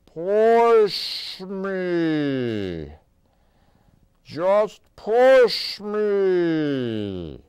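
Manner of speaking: loud, high, drawn-out yell. A narrator's reading. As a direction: yell it across the room. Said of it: "Danny yelled to his brother".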